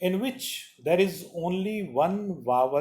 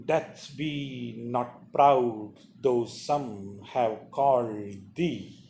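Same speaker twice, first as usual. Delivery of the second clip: slow, lower, solemn sonnet reading; poem recitation; 4 semitones lower, slower than usual. Acted. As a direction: read each phrase slowly, giving it weight. Reads the sonnet aloud.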